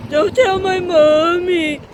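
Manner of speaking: put-on slow pleading child voice; kid pleading. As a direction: beg like a scared little kid.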